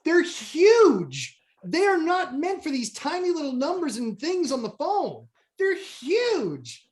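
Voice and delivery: high-pitched mock outrage; retold ranting. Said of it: "I'm screaming at my phone".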